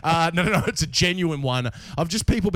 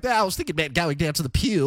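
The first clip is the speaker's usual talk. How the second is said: lightly voiced broad Australian grandmother; a hint of a thick Australian accent; imitating a grandmother's country Australian accent; mock broad Australian nan; 4 semitones higher than usual. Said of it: "your nan ... she's like".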